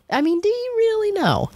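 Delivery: sky-high repeat of the challenge. Real, not acted. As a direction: press the question again, high and long. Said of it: "repeated rhetorical challenge".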